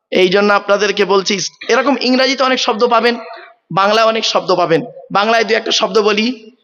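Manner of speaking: normal talking voice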